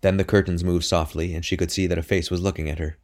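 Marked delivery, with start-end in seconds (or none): none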